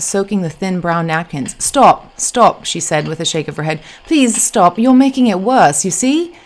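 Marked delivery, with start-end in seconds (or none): 1.6-2.6 higher, louder, urgent British protest. A narrator's reading. Woman stops man dabbing her blouse, urgent protest. 4.1-6.3 high, louder British protest, rising at the end; exasperated British plea. A narrator's reading. Woman tells clumsy helper to stop, exasperated plea.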